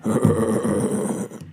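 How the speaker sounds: a little croaky toad chuckle; toad laughing. A narrator's reading. Toad laughing at the tiger from the crack, croaky chuckle. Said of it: "only laughed".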